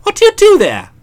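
high breezy probe; light nosy question; chatty Bertie. Acted. Bertie probing.

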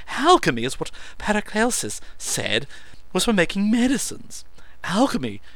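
high, loud oratory; orating. A narrator's reading. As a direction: declaim it like a lecture. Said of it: "he orated".

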